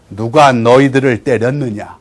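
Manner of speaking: low, slow, measured demand; lightly voiced lord demanding an answer; stern master. Master questioning his beaten servants, stern.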